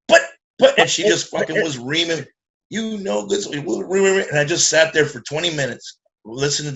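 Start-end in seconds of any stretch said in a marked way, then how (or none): 0.1-0.8 stammering to get a word in. Retold or imitated. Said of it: "That's how it went for ten minutes".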